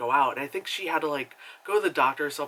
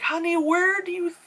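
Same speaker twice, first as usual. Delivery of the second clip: sassy retort. Retold or imitated. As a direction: talk back with pointed sass. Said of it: "And I'm like".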